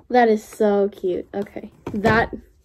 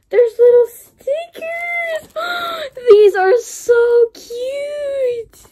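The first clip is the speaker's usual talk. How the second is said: very high, drawn-out gushing squeal; delighted squeal; 12 semitones higher, 4 dB louder, much slower than usual. Real, not acted. Unboxer finding little stickers, squealing with delight. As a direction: squeal with gasps, stretching the words in sheer delight. Finds stickers inside the gift.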